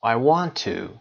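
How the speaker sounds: "to" with a full oo vowel; stressed, unreduced "too" for "to"; stressed strong "to". Shown as a demonstration. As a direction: say "to" like "too" at the end.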